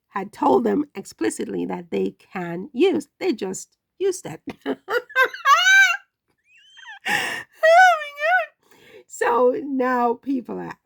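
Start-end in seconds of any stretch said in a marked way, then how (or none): 4.5-6.0 high, loud squealing laughter; shrieking laugh. Real, not acted. Laughing at princess title. 7.1-8.8 very high, loud stunned exclamation; shocked oh my God. Real, not acted. Reacting to princess title.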